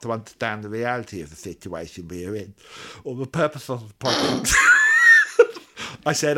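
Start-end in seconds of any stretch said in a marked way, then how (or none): 4.6-6.0 real loud high-pitched laugh. Real, not acted. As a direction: burst out laughing. Laughing after reading.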